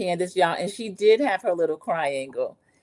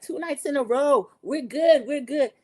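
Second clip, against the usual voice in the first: mock cheering; 7 semitones higher than usual. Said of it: "Maura and Erica, like".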